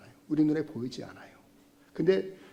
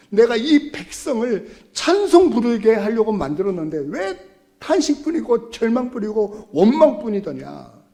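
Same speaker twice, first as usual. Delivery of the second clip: rebuking God; 6 semitones higher, 9 dB louder than usual. Acted. God voiced rebuking the people.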